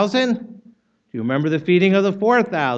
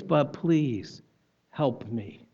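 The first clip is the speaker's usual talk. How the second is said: much softer, lower, pleading help; quiet plea; 4 semitones lower, 7 dB quieter, much slower than usual. Retold or imitated. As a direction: let the plea fade to almost nothing. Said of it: "here's a man who says".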